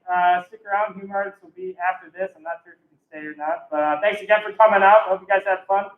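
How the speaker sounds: upbeat host wrapping up the night; emcee sign-off. Real, not acted. Host thanks the audience and closes the show, emcee style. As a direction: thank the crowd like a club emcee.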